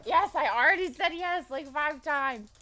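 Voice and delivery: high, loud, exasperated dog voice; voicing the dog. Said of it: "he's like".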